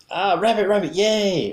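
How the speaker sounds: high, loud mock cheer at a match; acted delight. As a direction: celebrate a winning card, voice leaping up. Acting out a winning card match.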